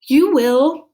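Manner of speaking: put-on high, loud girl promise; Minley reassuring dragon; tearful reassurance. A narrator's reading. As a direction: promise it brightly through tears. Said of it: "Minley said, blinking her tears away".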